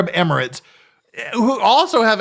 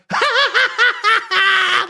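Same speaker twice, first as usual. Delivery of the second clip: mock villain laugh; 13 semitones higher than usual. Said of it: "laughing like a motherfucker".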